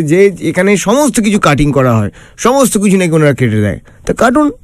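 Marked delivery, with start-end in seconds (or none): none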